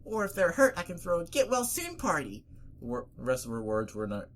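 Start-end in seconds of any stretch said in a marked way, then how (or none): 0.0-2.4 high, chattering character voice; bubbly party-throwing pony. Acted. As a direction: pitch it high and rattle on about parties.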